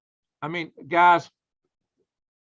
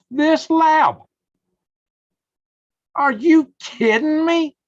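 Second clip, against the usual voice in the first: loud and much higher, slow; outraged shout; 13 semitones higher, 8 dB louder, much slower than usual. Real, not acted. Furious at the lab funding, shouting at his viewers. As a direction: shout it in disbelief.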